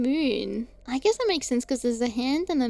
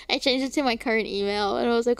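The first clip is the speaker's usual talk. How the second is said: usual speaking voice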